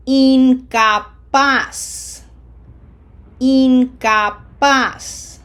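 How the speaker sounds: "Incapaz" loud and very slow, starting "een", stressed "-paz"; smiled Spanish I. Shown as a demonstration. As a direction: spell out "Incapaz" by syllables, final z as s. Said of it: "it's in".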